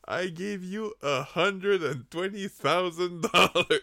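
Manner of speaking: slow, loud indignant complaint; indignant outburst. Retold or imitated. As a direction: spell out the outrage, slowly and loudly. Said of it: "Il était comme". (indignation lente et forte)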